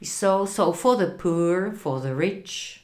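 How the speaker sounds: usual speaking voice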